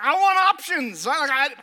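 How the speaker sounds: hammed-up wife demanding choices; mock-exasperated wife asking for a menu; imitating wife. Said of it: "She's like".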